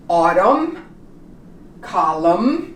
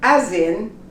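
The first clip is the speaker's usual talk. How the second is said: ordinary delivery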